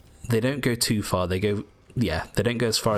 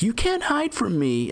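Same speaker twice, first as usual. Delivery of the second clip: much higher, drawn-out mock taunt; imitated enemy taunt; 13 semitones higher than usual. Player mimicking an enemy that lost track of him, high mock taunt. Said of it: "and they're like".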